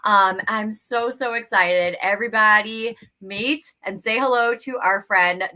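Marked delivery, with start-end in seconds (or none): none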